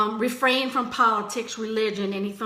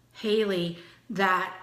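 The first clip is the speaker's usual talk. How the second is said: ordinary talk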